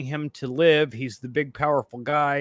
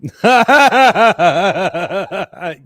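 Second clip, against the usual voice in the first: loud, rolling real belly laugh; 4 semitones higher, 10 dB louder than usual. Real, not acted. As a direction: roar with laughter at the joke. Laughing at the joke.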